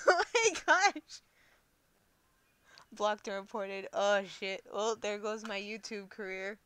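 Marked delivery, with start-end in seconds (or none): 0.0-1.2 high, loud, drawn-out laughing gasp; real laughing disbelief, loud and high; laughing exclamation. Real, not acted. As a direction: burst out laughing as you say it. Laughing reaction to viewer chat.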